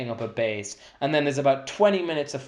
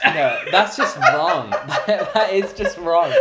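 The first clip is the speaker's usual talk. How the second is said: laughing; 5 dB louder, much slower than usual. Real, not acted. Laughing disbelief at Zulu answer.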